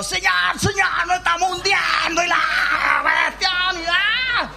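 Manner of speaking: frantic call to the master with a mock shout; desperate sailors shouting over the storm; a hammed-up cry that they are sinking; panicked cry for help.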